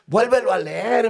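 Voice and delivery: high, loud retold yell; shouted command. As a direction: urge him loudly to read, imitating a prisoner. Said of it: "me gritó aquel hombre".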